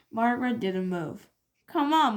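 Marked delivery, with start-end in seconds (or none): 1.7-2.2 urging. A narrator's reading. Susan urging again.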